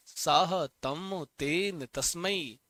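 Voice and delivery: slow, deliberate chant of साहतम तेन तस्मै; slow recitation. Shown as a demonstration. Teacher prompting students to repeat, chanting slowly. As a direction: lead the class through it slowly. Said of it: "क्या बनेगा".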